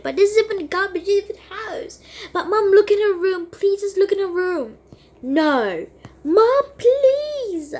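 high pleading whine; desperate begging. Acted. Daughter begging mom to look in the friend's room. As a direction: plead with everything you have.

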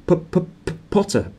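nervous teacher can't get it out; nervous stammer. Acted. Quirrell greeting Harry, nervous stammer. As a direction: stammer the P, trip over it. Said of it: "stammered Professor Quirrell".

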